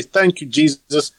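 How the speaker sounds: put-on a light New Zealand accent, deadpan gratitude; casual New Zealand accent. Quoting the congregation's reply in a mild New Zealand accent. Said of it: "their accent is so casual".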